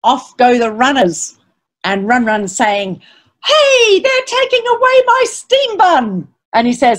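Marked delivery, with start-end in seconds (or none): 3.4-6.3 high-pitched cry of protest; outraged, much higher voice; indignant rat. Acted. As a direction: call out after the thief as a little rat. Rat protests as bun is taken.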